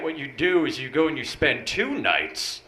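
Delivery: ordinary talk, nothing marked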